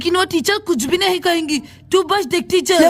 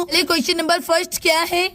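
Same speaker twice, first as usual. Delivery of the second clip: ordinary talk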